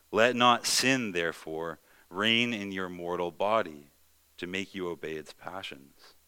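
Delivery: forceful, slowing preached reading; scripture reading. Acted. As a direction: declaim each clause with weight. Reads Romans verse twelve.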